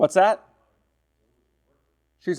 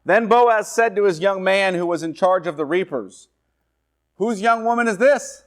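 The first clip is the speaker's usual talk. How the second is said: higher, raised reading voice; scripture reading; 6 semitones higher, 4 dB louder than usual. Acted. Preacher voicing Boaz's question to his servant. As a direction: lift Boaz's question off the page.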